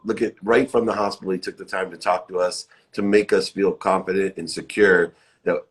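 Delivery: usual speaking voice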